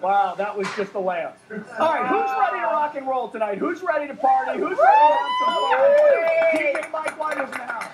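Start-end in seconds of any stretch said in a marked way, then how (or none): 1.8-7.9 loud, fast hype man; rowdy party animal. Acted. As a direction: pump up the crowd like an announcer. Hyping up the show opening.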